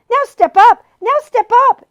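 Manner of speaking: high loud shouted advice; shouted counsel; calling out advice. A narrator's reading. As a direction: guide them from afar. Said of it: "it recommended".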